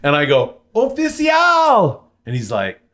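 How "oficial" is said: long, drawn-out, much higher call. Retold or imitated. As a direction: stretch the word out to reach a cop across the traffic. Calling out to cop in traffic.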